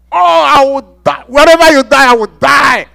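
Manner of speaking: high, loud, earnest promise to die alongside; impassioned loyal disciple; fervent vow. Retold or imitated. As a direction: swear it passionately, voice up and loud. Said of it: "It's talking like Peter".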